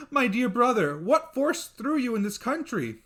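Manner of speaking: high, loud surprised cry; overwhelmed at seeing him; astonished sister. Acted. Sister greeting her youngest brother, high and astonished.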